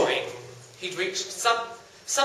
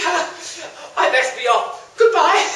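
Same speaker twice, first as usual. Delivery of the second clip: panicky; 6 semitones higher, 5 dB louder than usual. Acted. Hero flees in panic.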